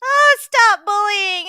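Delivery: hammed-up plea to stop bullying; mock whiny victim. Mocking Laura's cry about bullies, in a mock whine.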